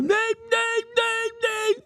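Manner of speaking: high-pitched nyeh-nyeh engine noise, half laughing; mock engine noise. Revving past other drivers after speeding up, high-pitched mock engine noise. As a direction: buzz it out like a little car flooring it.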